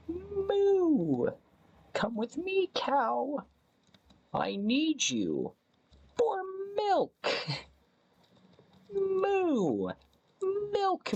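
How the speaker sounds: very high, pleading cow voice with moos; cow roleplay. Pretend. Streamer acting a cow wanting to be milked, very high pleading moos. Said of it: "I had to play the role of a cow and get milked".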